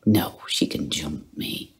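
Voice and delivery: ordinary delivery, nothing marked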